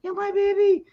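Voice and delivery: high mock wail of the owner; distraught dog owner. Owner running to her shot dog, high mock wail. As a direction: voice the woman crying for her puppy. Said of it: "the woman comes running up".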